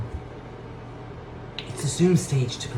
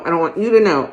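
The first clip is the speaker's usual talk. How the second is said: louder, pushed through a mask; stifled scream; 4 semitones higher, 7 dB louder, faster than usual. Acted. Shopper yelling to the clerk through her mask, louder. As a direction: yell to be heard behind the mask. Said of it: "trying to scream as loudly as I can".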